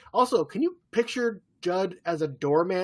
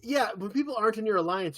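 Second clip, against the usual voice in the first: usual speaking voice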